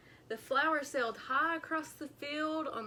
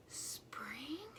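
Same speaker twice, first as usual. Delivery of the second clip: breathless awed whisper; 2 semitones higher, 13 dB quieter than usual. A narrator's reading. Groundhog whispering at the sight of the flower. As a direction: whisper it in wonder. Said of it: "he whispered".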